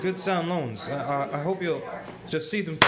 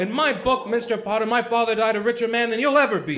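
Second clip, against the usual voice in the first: higher and louder, righteous fury; angry outburst; 5 semitones higher, 4 dB louder than usual. Acted. George defending his father to Potter, furious raised voice. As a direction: throw it straight in his face. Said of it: "all Tilly and I heard was a lot of yelling".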